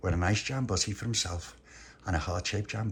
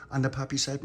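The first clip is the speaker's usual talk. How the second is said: ordinary delivery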